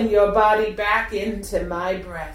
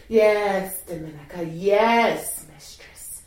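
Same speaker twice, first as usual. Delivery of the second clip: ordinary talk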